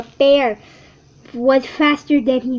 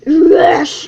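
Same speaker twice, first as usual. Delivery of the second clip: growled yes; 2 semitones higher, 8 dB louder than usual. A narrator's reading. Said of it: "Bear growled".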